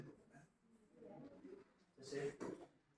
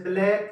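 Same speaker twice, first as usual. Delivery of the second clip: "Black" as one louder, stressed syllable; one-beat stress demo; 6 dB louder than usual. Shown as a demonstration. Teacher showing students a one-syllable word, louder single stress on "black". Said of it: "एक ही लफ्ज पे stress पड़ रहा".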